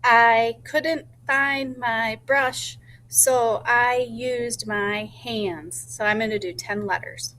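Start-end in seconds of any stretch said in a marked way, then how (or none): 0.0-5.8 slow, deliberate sentence told to the class; teacher telling her short story slowly to the class. Shown as a demonstration. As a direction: say each word slowly for the listeners. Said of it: "Can I tell you my story real quick".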